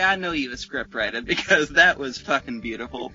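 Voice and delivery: ordinary talk, nothing marked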